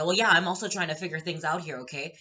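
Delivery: acted loud, defensive comeback; loud retort. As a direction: raise your voice at a pushy stranger. Acted retort to man in line.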